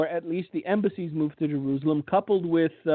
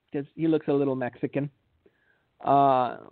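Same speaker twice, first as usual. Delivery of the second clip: usual speaking voice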